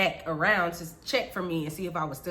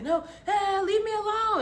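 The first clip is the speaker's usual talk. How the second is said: high, thin mock scream; high-pitched pleading protest; retold scream; 11 semitones higher than usual. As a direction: cry out in panic without much volume. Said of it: "And I screamed".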